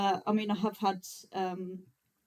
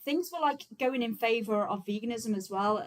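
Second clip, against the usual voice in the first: ordinary delivery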